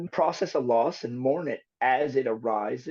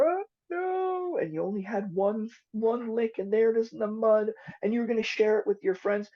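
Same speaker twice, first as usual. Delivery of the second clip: exaggerated sympathy; 8 semitones higher than usual. Voicing sympathy to child.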